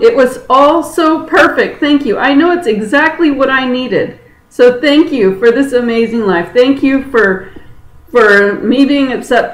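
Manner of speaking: laughing thanks bubbling up, pitched high; joyful laughing gratitude. Retold or imitated. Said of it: "And I'm laughing".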